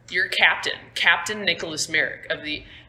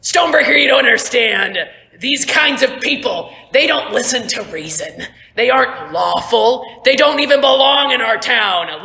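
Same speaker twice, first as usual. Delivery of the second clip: high, loud, righteous tirade; impassioned militia captain shouting orders; Captain Merrick; 6 semitones higher, 8 dB louder than usual. Acted. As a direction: berate your soldier and condemn the crowd. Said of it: "and he's just like".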